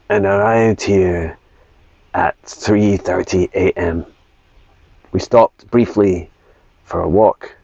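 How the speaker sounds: slow, deliberate voice for a pet dog; measured, word-by-word delivery as a talking dog; lightly voiced dog, giving a careful slow report; Patch. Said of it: "a voice like this".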